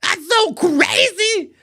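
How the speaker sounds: very high, loud shrieking falsetto; falsetto squeal. Shown as a demonstration. Host shows cohost the painful high voice, shrieking. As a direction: strain way up in the head voice. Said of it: "Does that hurt your voice".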